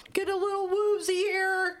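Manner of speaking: high, drawn-out hammed-up quote; mock woozy movie character. Quoting Stu's improvised lines, mock woozy voice. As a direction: stretch it out high like a dazed character.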